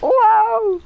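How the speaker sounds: normal talking voice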